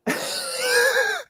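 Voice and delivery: very high, squealing laugh; real laughing fit. Real, not acted. Cracking up at the muting mishap, high laughing fit. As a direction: break up in high-pitched laughter.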